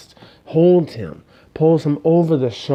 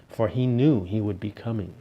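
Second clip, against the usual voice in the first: usual speaking voice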